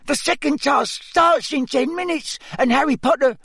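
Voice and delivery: very high, squeaky elf voice; squeaky house-elf. Acted. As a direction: squeak it like a tiny anxious elf. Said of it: "squeaked Dobby".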